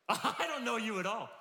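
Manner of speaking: high, loud, laughing retake; the servant's insolence, laughed out; laughing mock accusation. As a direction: toss the line back through laughter. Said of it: "he says this".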